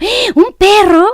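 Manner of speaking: big slow excited call; excited exclamation. Shown as a demonstration. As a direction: wow the child with it. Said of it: "le llamaría más la atención".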